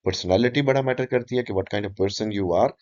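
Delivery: ordinary delivery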